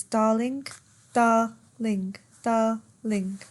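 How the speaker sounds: "darling" split into dar-ling; dua suku kata. Shown as a demonstration. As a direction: say "darling" slowly in two separate beats. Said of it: "has more than one syllable is".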